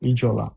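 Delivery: ইজলা with s voiced to z, izola. Shown as a demonstration. Teacher explains ইজলা said with z not s. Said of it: "ইসলো না হয়ে ইজলা".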